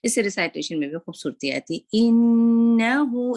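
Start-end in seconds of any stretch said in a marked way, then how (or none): 2.0-3.4 loud, slow chant with a long nasal hum; इन्नहु chanted, nun held long; full ghunna. Shown as a demonstration. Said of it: "इसको इन्नहु नहीं पढ़ना".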